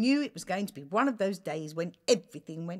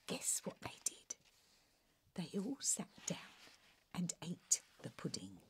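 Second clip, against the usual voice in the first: softer hushed whisper; 3 semitones lower, 10 dB quieter than usual. Real, not acted. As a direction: whisper the secret reveal. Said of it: "storyteller hushes for the reveal".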